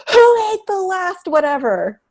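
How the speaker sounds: high, slow mock scold; mock angry question. Mom confronting the kids about the last snack, high slow mock anger. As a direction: demand it like a cross mom, half playing. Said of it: "kind of angry thing".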